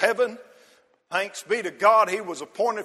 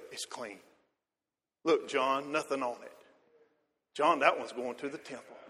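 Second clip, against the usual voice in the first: soft, hushed and fond, admiring the lamb; tender fatherly voice; 5 semitones lower, 7 dB quieter than usual. Retold or imitated. Zacharias quietly showing his son John the spotless lamb, softly tender. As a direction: murmur to your son over a perfect lamb. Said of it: "I can see Zacharias saying".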